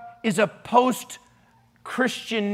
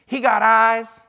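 lightly mimicked kid, louder; little girl's dialect; 5 dB louder, slower than usual. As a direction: blurt it like a blunt little girl, lightly imitated. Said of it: "This is the way she talked".

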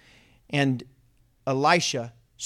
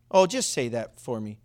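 ordinary delivery

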